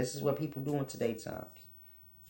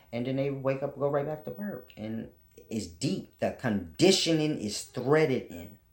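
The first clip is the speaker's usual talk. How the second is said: normal talking voice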